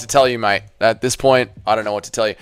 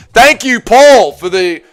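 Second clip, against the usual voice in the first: excited thanks; 6 semitones higher, 5 dB louder than usual. Real, not acted. Thanking donor, drawn out.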